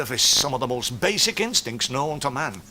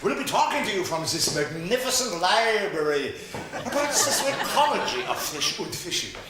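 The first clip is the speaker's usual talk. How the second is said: put-on German accent; 7 semitones higher than usual. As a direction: play a pompous German doctor. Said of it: "psychiatrist Doctor Heinz von Meatball".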